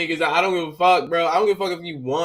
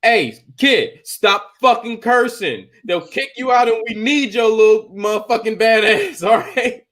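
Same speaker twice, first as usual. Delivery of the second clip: loud, raised-pitch telling-off; mock scolding; 5 semitones higher, 5 dB louder than usual. Player telling kid to stop cursing, mock-stern and loud. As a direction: scold hard, then break into a laugh.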